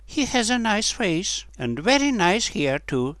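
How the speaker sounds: put-on high-pitched girl's voice; little girl. A narrator's reading. One girl to the other, high little-girl voice. Little girls remark on the boy.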